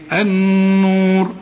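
النور said slowly with a held nasal ن; slow recitation keeping the ghunna. Shown as a demonstration. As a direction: hum the ن of النور. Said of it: "اوعى تنسى الغنة".

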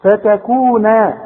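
فتكون slowly, ending in a long held naa; full final alif. Shown as a demonstration. Quran teacher models the pause form of فتكون, long final alif. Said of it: "عندك ألف اهي كاملة".

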